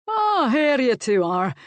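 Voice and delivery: high, loud cheerful greeting; hearty call. A narrator's reading. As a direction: announce yourself as you burst in. Uncle bursts in cheerfully.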